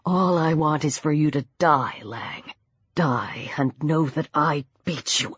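low, soft, venomous wish; deadly quiet menace. A narrator's reading. Peter strangling Lang, quietly menacing. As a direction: wish him dead in a deep hush.

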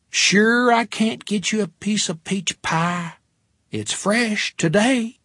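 high, slow Texas waitress drawl; Texas accent. A narrator's reading. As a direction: stretch it out in a sweet Texas accent. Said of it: "in a Texas accent".

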